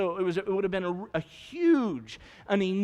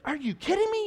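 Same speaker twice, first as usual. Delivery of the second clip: incredulous; 12 semitones higher than usual. Retold or imitated. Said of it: "you're like".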